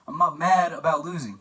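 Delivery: hammed-up insistence on the word mad; mock-emphatic Joe. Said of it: "He says it again".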